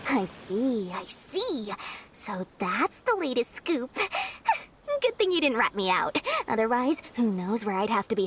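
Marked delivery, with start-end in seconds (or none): 4.0-4.6 short bright laugh that rises high; chuckle, pleased with yourself; amused laugh. Acted. Merchant amused at the scoop.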